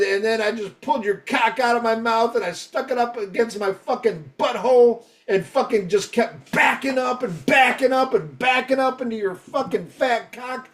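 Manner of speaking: high-pitched, escalating mock tirade; hammed-up outrage; retold brutal threat. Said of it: "that was the most brutal one".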